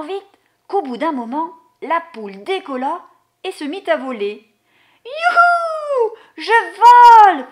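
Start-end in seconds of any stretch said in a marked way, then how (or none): 5.1-7.5 high, loud whoop of a flying hen; squealing hen shouting with joy; joyful cry. Acted. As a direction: cry out with glee as she takes off. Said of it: "fit la poule".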